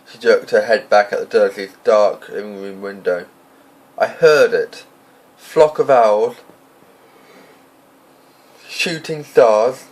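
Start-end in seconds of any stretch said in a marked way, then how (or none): 4.0-9.8 drawn-out scoffing list; scornful, slow. A narrator's reading. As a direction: scoff at each rumour slowly. McGonagall scoffing at rumours.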